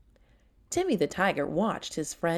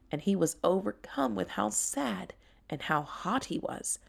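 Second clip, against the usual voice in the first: ordinary delivery, nothing marked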